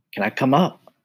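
lightly voiced mouse. Tucker asks cricket.

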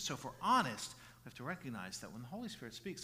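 usual speaking voice

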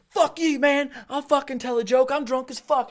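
loud, high drunken-heckler imitation. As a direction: holler back like a belligerent drunk. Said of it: "I catch one drunk guy".

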